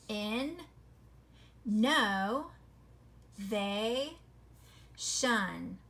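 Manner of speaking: "In, no, they, shun" each piece split apart with pauses; syllable breaks. Shown as a demonstration. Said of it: "according to the way we say it".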